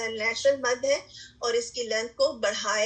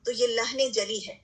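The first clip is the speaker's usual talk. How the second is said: usual speaking voice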